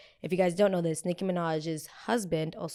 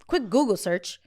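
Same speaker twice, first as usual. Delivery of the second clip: normal talking voice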